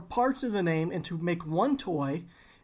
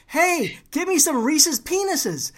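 raised, loud call to a coworker; yelling; 8 semitones higher, 5 dB louder than usual. Retold or imitated. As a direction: call out across the room. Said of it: "And I yelled".

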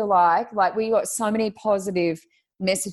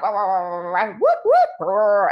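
broken call audio; 4 dB louder, much slower than usual. Retold or imitated. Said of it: "It was like".

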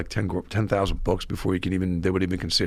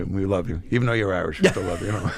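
normal talking voice

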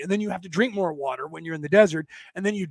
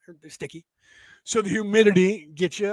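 ordinary delivery

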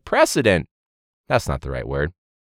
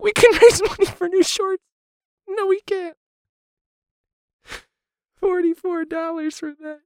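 high mocking scoff; sarcastic. Real, not acted. Teacher scoffing at raising money for shorts, high.

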